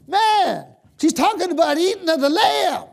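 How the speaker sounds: high and loud, bursting with excitement; excited shout. Retold or imitated. Telling his wife, in an excited near-shout. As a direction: blurt it out, thrilled by the idea. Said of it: "I started to shout".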